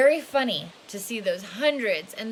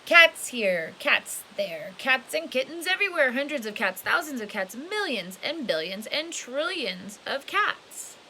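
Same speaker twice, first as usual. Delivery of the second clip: excited storybook build; 4 semitones higher than usual. A narrator's reading. Reading to kids, building excitement over the cats. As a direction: pile the cats up, bigger each time.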